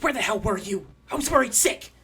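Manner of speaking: high, loud scolding worry; half-orc woman scolding her partner, high and worried. Retold or imitated. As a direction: scold him like a frantic partner. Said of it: "going like".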